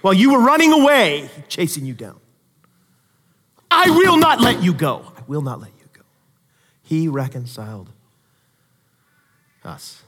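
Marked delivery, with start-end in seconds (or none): 3.7-5.1 raised, firm, each word planted; firm loud declaration. Retold or imitated. God chasing the runaway down, a loud firm vow. 5.2-6.0 hushed, lower and gentle; soft tender repeat. Retold or imitated. God holding the runaway close, a hushed tender echo. Repeated softly after loud take.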